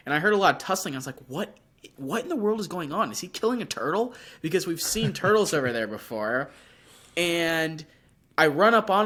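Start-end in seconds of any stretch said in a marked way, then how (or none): 1.3-4.1 higher, quicker puzzled questions; baffled, racing to guess what's happening; retold puzzled alarm. Retold confusion at tussling noise.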